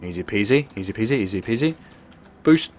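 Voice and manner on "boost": high, loud repeated call; excited high-pitched yell; urgent urging. Real, not acted. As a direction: shout it to spur the vehicle on. Urges vehicle on mid-stunt.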